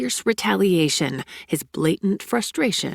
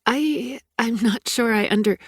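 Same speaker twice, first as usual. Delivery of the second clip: higher, flustered, cut off; flustered, breaking off; 6 semitones higher, 3 dB louder than usual. Acted. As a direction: start high and trail into confusion.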